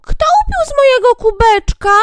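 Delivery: very high, loud squeaky dwarf. Acted. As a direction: squeak it out like the littlest one. Seventh dwarf asks. (bardzo wysoki pisk krasnoludka)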